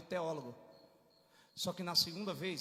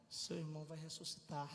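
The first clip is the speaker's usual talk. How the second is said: soft, low, tranquil voice; gentle, lowered reassurance; calm; 4 semitones lower, 10 dB quieter than usual. Shown as a demonstration. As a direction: tell it quietly and evenly. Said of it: "É tranquilo ou não é?".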